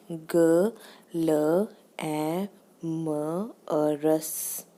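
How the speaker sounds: ग ल ए म अ रस sound by sound, slow, with gaps. Shown as a demonstration. Teacher breaking down the example word, slow separate sounds ग ल ए म अ रस. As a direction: break ग्लैमरस into ग, ल, ए, म, अ, रस. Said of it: "इन्होंने एग्जांपल दी है ग्लैमरस".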